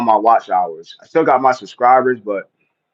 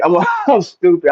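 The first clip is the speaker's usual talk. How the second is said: laughing through the words, pitched up; laughing at himself; 8 semitones higher, 3 dB louder than usual. Real, not acted. Realizing he misread the chat, laughing at himself. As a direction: chuckle at his own slip.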